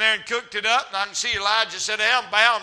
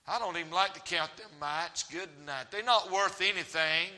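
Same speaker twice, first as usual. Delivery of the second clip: grumbling treasurer; 5 semitones lower, 10 dB quieter than usual. Retold or imitated. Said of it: "I can see the old treasurer say".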